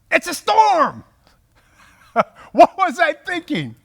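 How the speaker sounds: high, loud panicked cry breaking into a laugh; mock panic. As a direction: play [name]'s sudden hammed-up fright, then laugh at yourself. [name] panics seeing the storm.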